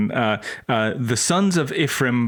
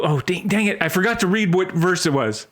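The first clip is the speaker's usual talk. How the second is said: ordinary talk, nothing marked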